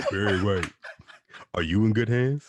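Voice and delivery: loud, booming ad-man voice, laughing through it. Pretend. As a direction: boom it like the Allstate pitchman. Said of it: "It sound like the Allstate man".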